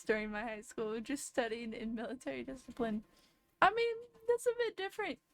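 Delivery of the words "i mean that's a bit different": much higher, laughing as she speaks; amused. Real, not acted. A friend comparing her strict school days: amused high laugh. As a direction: chuckle it out, pitched high.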